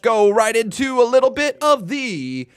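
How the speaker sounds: loud, high announcer hype with drawn-out words; show announcer. Acted. Stream host opening the roll call for viewers, hyped announcer. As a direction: build it up big, rolling into the segment name.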